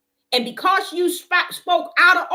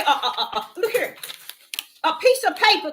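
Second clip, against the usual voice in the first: ordinary delivery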